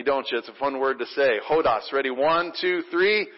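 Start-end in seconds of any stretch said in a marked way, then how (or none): 1.5-1.9 "Hodos" said clearly as HOH-dos; saying hodos. Shown as a demonstration. Pastor modelling "Hodos" for the congregation. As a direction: say "Hodos" slowly, two clear syllables. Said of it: "say that together with me".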